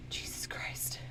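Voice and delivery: breathy under-the-breath curse; whispered exasperation. Real, not acted. Fed up with the puzzle, whispered curse. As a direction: whisper it in despair.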